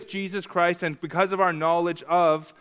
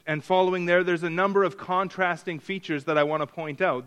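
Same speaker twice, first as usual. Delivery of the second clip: usual speaking voice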